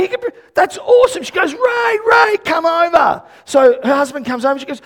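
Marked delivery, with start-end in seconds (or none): 0.0-1.2 high-pitched excited reaction; squeaky-high thrilled reply; retold amazement. Retelling her excited reaction to healing. 1.5-3.2 high-pitched calling to a husband; excited summons, pitched high; imitated excited call. Woman calling her husband over, retold.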